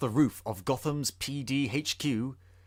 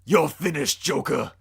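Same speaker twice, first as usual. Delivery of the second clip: growl; 5 dB louder than usual. Acted. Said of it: "I said with a growl".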